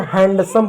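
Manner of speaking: हैंडसम with a sounded d; D pronounced. Shown as a demonstration. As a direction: keep the d in हैंडसम. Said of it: "हैंडसम नहीं होता है". (डी बोलकर "हैंडसम")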